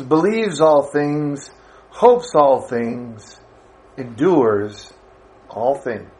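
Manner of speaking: slow, measured Bible reading that slows further toward the end; scripture reading. Acted. Preacher reading Corinthians aloud to the church, measured and reverent. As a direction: read the passage reverently to the congregation.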